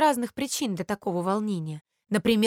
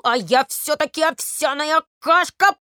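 higher, louder bubbly boast; bubbling porridge boast; 6 semitones higher, 7 dB louder than usual. A narrator's reading. Porridge boasting as its bubble bursts, higher and louder. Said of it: "лопался и говорил".

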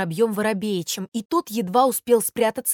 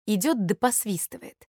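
usual speaking voice

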